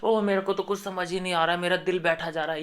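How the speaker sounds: soft, hurried mock helplessness, pitched slightly up; mock overacted cluelessness, quiet and rushed; mocking Archana's acting. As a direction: imitate put-on bewilderment in a small, quick voice. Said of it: "अर्चना ये acting कर रही थी ना".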